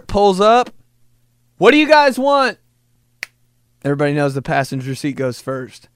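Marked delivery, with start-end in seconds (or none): 1.6-2.6 mom calling out. Retold or imitated. Mom at drive-thru speaker.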